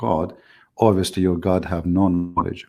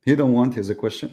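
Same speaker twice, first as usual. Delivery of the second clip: usual speaking voice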